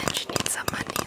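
ordinary talk

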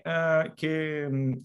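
ordinary talk, nothing marked

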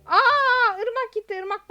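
sudden high, loud outburst of shock; shocked high yelp; shocked cry. Real, not acted. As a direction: yelp as the friend vanishes. Friend suddenly banned in game.

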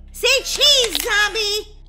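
shouted high, say cheese; loud photo call. Acted. Girl yelling cheese at a zombie, loud. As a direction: call it out like snapping a picture.

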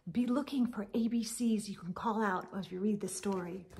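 fast whisper; whispered aside. Real, not acted. A reader telling young listeners to watch for ABCs, whispering. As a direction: let them in on a secret. Telling children what to look for.

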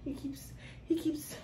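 hushed aside; soft whispered aside. Real, not acted. Aside about baby saying Dada.

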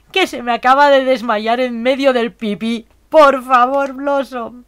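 loud, high pleading complaint; exasperated outcry. Real, not acted. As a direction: plead loudly in dismay. Still reacting to fainting sim.

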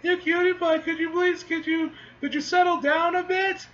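put-on high, cooing baby-talk voice. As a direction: coo at a cute pet. Character coos at dragon.

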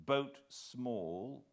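ordinary delivery

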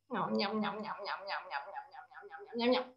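soft, low munching nom noms; munch it quietly like a dog; eating noises. Acted.

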